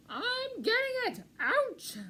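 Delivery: high, loud pained critter voice; strained creature cry. A narrator's reading. Pack rat fighting the cactus, high pained cries. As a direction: struggle and yelp at once.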